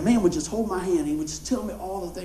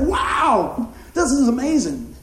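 loud, laughing cry of wonder; amazed; 5 semitones higher, 4 dB louder, much slower than usual. Retold or imitated. Preacher retelling his astonishment to the congregation. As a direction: let the amazement burst out. Amazed at the old man's story.

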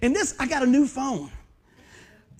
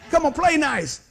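louder, laughing come on, play nice; mock soothing; 2 semitones higher, 6 dB louder, slower than usual. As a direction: coax fighters like squabbling kids. Pastor breaking up a fight.

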